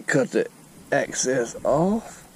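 usual speaking voice

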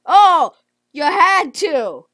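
loud, slow accusing cry; real indignant yell; indignant shout. Real, not acted. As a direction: blame them loudly. Got eaten in game.

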